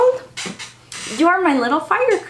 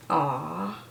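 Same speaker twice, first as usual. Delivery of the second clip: tender aw; 6 semitones lower, 9 dB quieter than usual. Real, not acted. Touched by the book.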